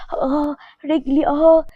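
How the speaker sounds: high, slow moan of pain; pained cry.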